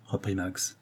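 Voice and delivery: plain reading voice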